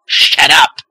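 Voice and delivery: loud snapped order; angry shout. Acted. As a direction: yell it with a hand slam. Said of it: "slams his hand on his desk".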